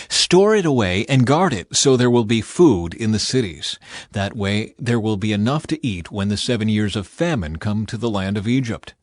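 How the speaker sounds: usual speaking voice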